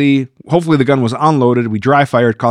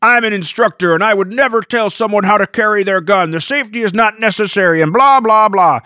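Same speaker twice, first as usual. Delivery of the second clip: higher, whiny mock voice for the know-it-all; sneering impression of a commenter; 6 semitones higher than usual. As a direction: send up the smug instructor. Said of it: "where a guy was like".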